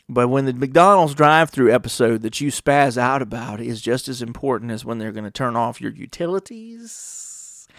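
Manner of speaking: usual speaking voice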